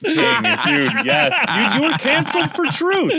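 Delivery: high, fast laughing praise. Real, not acted. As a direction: cackle it out in delight.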